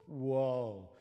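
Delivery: ordinary talk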